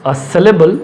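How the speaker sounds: word by word, with long pauses; dictating while writing. Real, not acted. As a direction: dictate it slowly in short chunks as you write. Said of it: "मैं लिख रहा हूँ". (लिखते हुए रुक-रुक कर बोलना)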